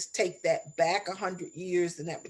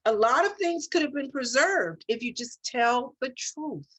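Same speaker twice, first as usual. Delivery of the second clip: impassioned; 5 semitones higher than usual. Real, not acted. Earnest plea about love and truth.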